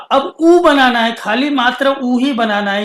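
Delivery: ordinary delivery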